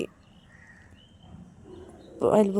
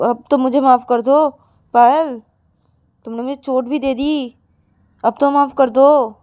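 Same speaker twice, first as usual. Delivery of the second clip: louder, insistent begging with raised pitch; pleading; 4 semitones higher, 7 dB louder than usual. Acted. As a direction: beg a hurt partner's forgiveness. Acted begging for forgiveness. (मिन्नत करते हुए माफ़ी माँगना)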